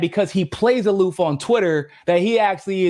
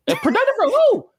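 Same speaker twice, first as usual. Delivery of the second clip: pitched-up scoffing jab; mocking retort. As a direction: sneer the question back in a silly high voice. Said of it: "I'm like".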